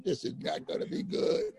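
unvoiced worried whisper; hushed mock dread; whispered aside. Aside on seeing the winos.

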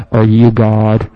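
loud, slow, playful mouth-on-belly question; loud goofy voice to the dog; talking to the dog. Retold or imitated. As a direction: call into the dog's tummy. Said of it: "talking to her like that".

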